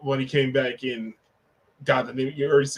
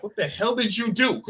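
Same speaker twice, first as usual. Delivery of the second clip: mock shocked question; 8 semitones higher than usual. Imagined shock in the car.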